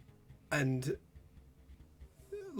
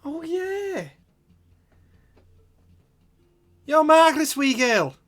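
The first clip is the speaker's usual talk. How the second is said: put-on very high, gushing old woman; feigned recognition; 14 semitones higher, 9 dB louder, slower than usual. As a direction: burst into delighted recognition, high-pitched and loud. [name] pretends to recognise her.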